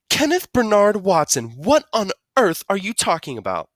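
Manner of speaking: high, loud motherly scolding; exasperated scolding. Acted. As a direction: demand an explanation from your son. Mama scolds Kenny with full name.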